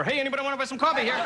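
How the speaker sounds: high and fast, wired on caffeine; hyper coffee vendor. Retold or imitated. Coffee vendor hawking to fans, high and fast. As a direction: rattle it off like you drank all the coffee. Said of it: "The coffee guy's a little hyper".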